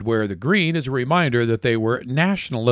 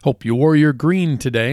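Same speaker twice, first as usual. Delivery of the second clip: ordinary talk, nothing marked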